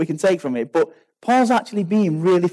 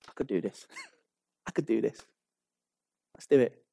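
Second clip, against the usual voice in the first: soft, low, self-assured muttering to himself; a hint of Satan plotting under his breath; quietly scheming Satan; 6 semitones lower, 10 dB quieter, faster than usual. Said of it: "And Satan says".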